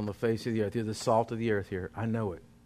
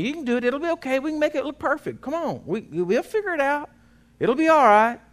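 high, bright cheering on; upbeat encouragement; 12 semitones higher, 5 dB louder than usual. Retold or imitated. Boss cheering his workers on, high and upbeat. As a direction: pump up the guys with high cheer. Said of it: "always telling the guys around me".

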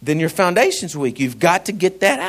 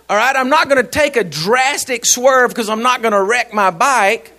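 ordinary talk

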